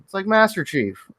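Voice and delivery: usual speaking voice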